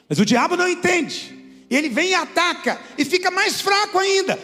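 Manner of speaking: preaching at full force. Acted. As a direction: preach it to the back row. (pregação inflamada, em voz alta)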